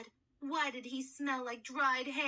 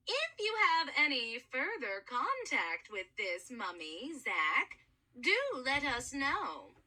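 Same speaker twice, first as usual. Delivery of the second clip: Mrs Coleman Levin's voice. Acted.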